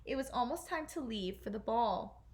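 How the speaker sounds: usual speaking voice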